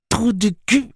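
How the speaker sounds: high, loud parroting creature; talking animal. A narrator's reading. Creature echoing the pirate's insult, high animal voice. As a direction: parrot it back through bared teeth. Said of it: "dit Oté entre ses dents".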